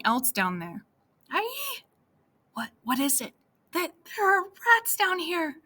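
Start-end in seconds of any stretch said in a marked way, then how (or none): 1.3-1.8 high frightened yelp in the dark; startled shriek. Acted. Anya spotting rats, sudden high squeal. 2.5-5.6 high, shaky panic over rats; scared wife blurting out alarm; frightened. Acted. Anya panicking to Vladek about rats in the cellar, scared.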